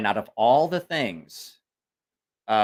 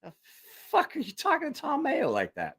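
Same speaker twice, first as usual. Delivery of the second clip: mock shocked outrage; 14 semitones higher, 4 dB quieter than usual. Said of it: "And everybody's like".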